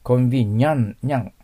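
コンビニャン with a clear cat-like ニャン; nyan sound. Shown as a demonstration. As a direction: isolate and repeat the ニャン of コンビニャン. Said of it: "ニャンコさんのニャンが入ります".

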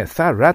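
"thorough" said as two short beats, "tha-ra"; thorough as "sara". Shown as a demonstration. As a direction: stress the first syllable of "thorough", ending in a short "ra". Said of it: "と発音するような感じがいいです". (thorough を「サラ」のように発音)

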